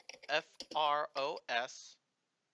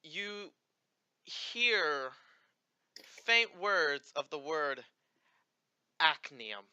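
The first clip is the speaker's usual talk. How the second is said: game master narration. Acted. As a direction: let each word hang like a distant voice. GM voices the sky.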